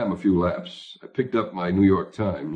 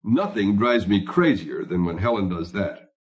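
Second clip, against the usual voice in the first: plain reading voice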